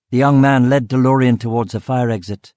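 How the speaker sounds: ordinary delivery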